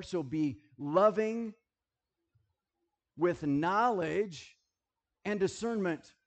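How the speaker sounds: each phrase stretched slowly and hit hard; slow emphatic exhortation. Acted. Preacher urging his church, slow and emphatic. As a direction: drive the point home slowly. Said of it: "preaching exhortation".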